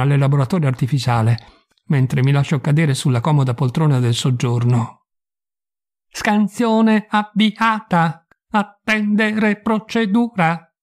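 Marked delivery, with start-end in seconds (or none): 6.1-10.7 very slow, high synthetic voice; flat computer readout, word by word; emotionless robot. Acted. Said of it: "mi risponde la I A".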